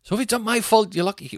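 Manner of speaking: high, protesting retold argument; exasperated self-defence. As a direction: plead innocence to an angry partner. Retold self-defence in phone row.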